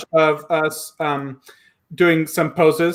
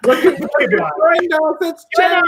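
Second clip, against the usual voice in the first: real excited, high-pitched announcement; excited welcome; 10 semitones higher than usual. Real, not acted. Host brings the choreographer on screen, excited welcome. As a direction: greet a friend's arrival with high, delighted surprise.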